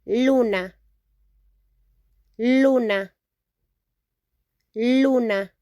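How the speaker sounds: luna with clear L and pure u vowel; teacher modelling luna; Spanish pronunciation. Shown as a demonstration. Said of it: "We called moon in Spanish".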